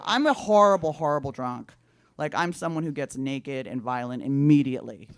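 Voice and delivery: ordinary talk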